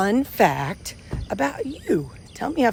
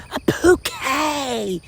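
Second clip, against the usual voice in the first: imitating a country kid, very drawn out and higher; little country accent; 7 semitones higher, much slower than usual. Little boy repeats it for his mom, drawn-out country accent. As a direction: stretch it out in a proud country drawl. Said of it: "Little country accent".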